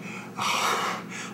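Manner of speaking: fed-up exhale; exasperated sigh. Acted. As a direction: sigh at all the questions. Fed up with her questions.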